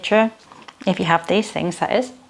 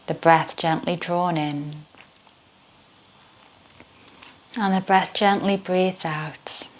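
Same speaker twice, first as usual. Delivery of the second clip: guided meditation. Acted. Leads yoga nidra meditation.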